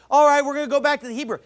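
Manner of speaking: quick, high, loud mock lecturer; trying to sound smart. Mocking Bible college preachers citing Hebrew, quick high mimicry. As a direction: mimic a know-it-all breezing into his lesson. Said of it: "trying to sound all smart".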